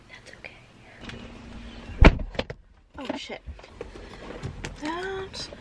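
soft slow whisper to herself. Real, not acted. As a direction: breathe it out, barely voiced. Late, brushing it off to herself.

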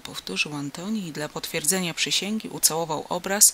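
plain reading voice

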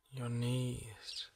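soft, slow relaxation voice with long pauses; hushed, unhurried body-scan meditation; guided meditation. Acted. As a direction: gently guide the listener into calm, leaving space between phrases.

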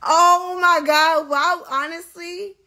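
slow, drawn-out loud outcry; loud exasperated. Real, not acted. Outraged at Shade Room post.

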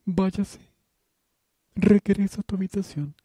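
high, loud barked commands; shouted orders. Acted. Headmistress yelling Candy back to her room, high loud orders. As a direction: keep yelling her out the door.

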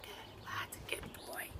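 whispered praise to a dog; soft, breathy approval; hushed praise. Real, not acted. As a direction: praise the dog very softly, under your breath.

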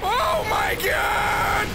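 high, drawn-out cry of disbelief; alarmed shout. Real, not acted. Player overwhelmed by the game, alarmed shout.

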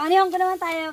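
ordinary delivery

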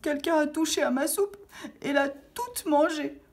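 high, whimpering little bear; petit ours qui pleure. A narrator's reading. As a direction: wail it in a tiny high-pitched bear voice. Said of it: "se mit à pleurer tout bas". (petite voix aiguë d'ourson en pleurs)

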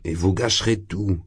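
plain reading voice

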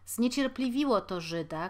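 usual speaking voice

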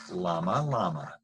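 usual speaking voice

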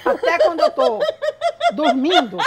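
laughing quote. Retold or imitated. Quoting complainant, laughing.

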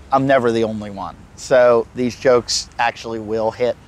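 ordinary talk

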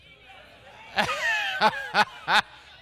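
big high burst of laughter; loud high laugh. Real, not acted. Pastor laughing at his teasing flock. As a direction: throw back your head and laugh.

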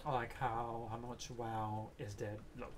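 usual speaking voice

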